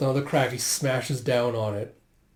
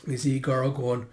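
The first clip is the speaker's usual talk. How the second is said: ordinary delivery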